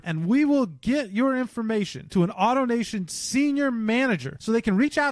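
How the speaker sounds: upbeat sponsor ad read; podcast host reading a sponsor ad. Acted. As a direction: sell it like a radio spot. Host closes ad.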